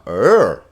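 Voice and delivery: "er" with the pitch rising, then falling; loud, looping rise-fall on "er". Shown as a demonstration. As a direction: arch the pitch up and back down on a loud "er". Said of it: "the circumflex went up and down".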